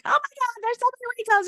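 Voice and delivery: high, shrill-free squeal of dismay; mock horrified high voice; panicked human self. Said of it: "slip into my human self".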